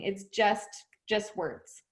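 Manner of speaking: ordinary delivery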